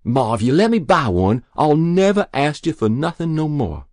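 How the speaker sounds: high, loud earnest begging. A narrator's reading. Boy promising anything for a gun, begging. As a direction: bargain desperately with her.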